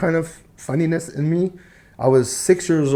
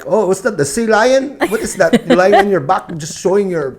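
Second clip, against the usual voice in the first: lightly mimicked uncle, higher pitch; mock-puzzled uncle with raised voice; heckling uncle; 7 semitones higher, 5 dB louder than usual. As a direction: poke fun at the kid's dancing. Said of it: "my uncle would be like".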